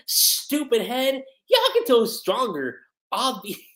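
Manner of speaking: high-pitched, indignant retort; super offended. Acted. Offended fan defending Yakuto's strength, high-pitched indignation. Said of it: "super offended by this".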